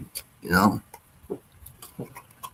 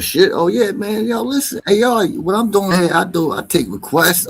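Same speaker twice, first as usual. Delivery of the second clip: much higher, louder, pumped up; hyped streamer; 10 semitones higher, 3 dB louder than usual. Real, not acted. Streamer welcoming the audience to his stream, high and excited. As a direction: rev up the chat.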